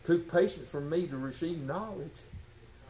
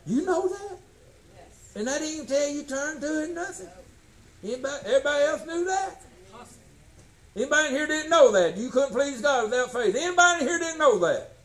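higher fast preaching drive; 9 semitones higher, 3 dB louder than usual. Acted. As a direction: press the congregation hard. Preaching at full force.

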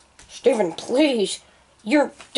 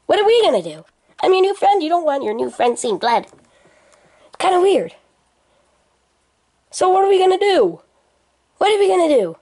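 put-on Junior voice; 7 semitones higher than usual. Junior frets about the blood in his put-on voice. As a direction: keep the squeaky new-friend act going. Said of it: "That's not your real voice".

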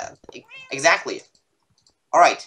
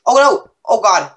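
sudden loud alarm; startled shout; 5 semitones higher, 6 dB louder than usual. Real, not acted. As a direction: yelp in shock. Sudden attack in game.

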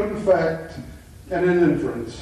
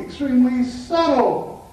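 extremely subtle, pushed higher and louder; stress on subtle; 7 semitones higher, 4 dB louder than usual. Shown as a demonstration. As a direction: lean hard on subtle. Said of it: "emphasize that word".

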